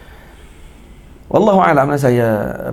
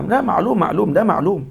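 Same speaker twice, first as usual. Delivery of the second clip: mock impatient reply; 7 semitones higher than usual. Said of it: "Dah kata dah".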